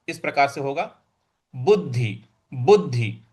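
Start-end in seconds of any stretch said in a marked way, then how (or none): 1.5-3.2 बुद्धि with the half द struck; stress on द्ध. Shown as a demonstration. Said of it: "उस पर हमको आघात देना है". (द्ध पर आघात)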